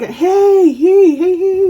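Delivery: high, loud repeated holler; drawn-out attention-getting yell; imitated calling out. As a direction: imitate a man hailing the neighbours. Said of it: "like that".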